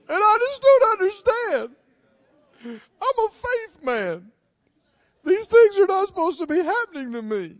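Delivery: high, loud complaining whine; doubting believer. Acted. Preacher mocks a doubter's complaint, high and whiny. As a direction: whine like a believer shaken by trouble. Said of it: "That's not how a faith man talks".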